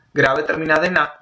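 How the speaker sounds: ordinary talk, nothing marked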